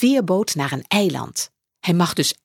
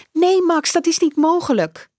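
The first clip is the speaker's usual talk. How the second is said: high, fast, louder woman's voice; hurried motherly excuse; apologetic mother; 11 semitones higher, 3 dB louder than usual. Acted. Mother on the phone from work, high and hurried.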